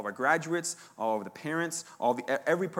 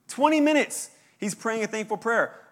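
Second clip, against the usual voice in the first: emphatic amazement; 8 semitones higher, 6 dB louder than usual. Real, not acted.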